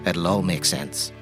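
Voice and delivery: normal talking voice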